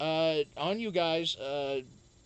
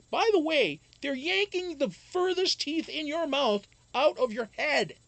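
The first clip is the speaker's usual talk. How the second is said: emphatic; 10 semitones higher, 4 dB louder than usual. Real, not acted. Emphatic aside about yanked teeth.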